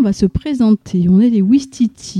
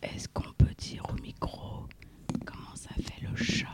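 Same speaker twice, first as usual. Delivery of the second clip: whispering; 17 dB quieter than usual. Shown as a demonstration. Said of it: "quand on parle tout bas comme ça".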